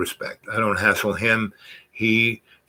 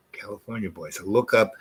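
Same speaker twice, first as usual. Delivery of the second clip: ordinary delivery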